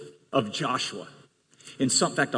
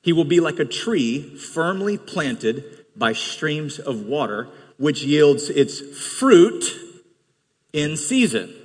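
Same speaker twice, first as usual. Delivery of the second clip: scripture reading; 4 semitones lower than usual. Acted. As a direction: read the psalm gently and slowly. Reads Psalm one aloud.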